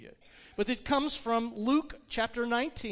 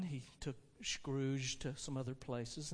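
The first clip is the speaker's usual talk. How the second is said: ordinary talk, nothing marked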